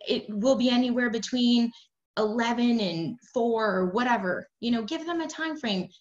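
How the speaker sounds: usual speaking voice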